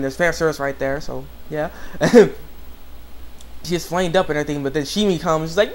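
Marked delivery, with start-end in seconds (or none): none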